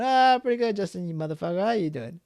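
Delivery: friend replying. Retold or imitated. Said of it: "You know".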